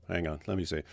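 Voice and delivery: ordinary talk, nothing marked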